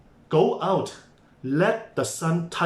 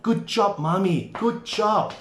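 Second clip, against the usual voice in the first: higher, bright self-praise; cheerful praise; 5 semitones higher than usual. Shown as a demonstration. Tired parent praising herself, bright and cheerful. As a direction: cheer yourself on like a proud coach. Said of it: "But you know what?".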